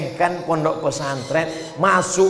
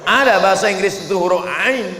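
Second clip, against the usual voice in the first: loud challenge; 5 semitones higher, 3 dB louder than usual. Acted.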